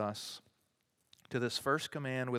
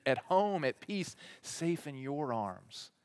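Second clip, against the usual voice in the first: usual speaking voice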